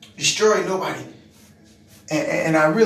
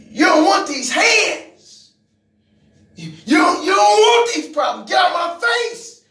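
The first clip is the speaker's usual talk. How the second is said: much higher and louder, daring him; threatening shout; 9 semitones higher, 6 dB louder than usual. Retold or imitated. Preacher reliving threatening the coworker in his face, shouting. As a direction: warn him off before a fight.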